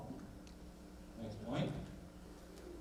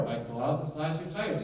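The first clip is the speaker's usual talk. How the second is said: normal talking voice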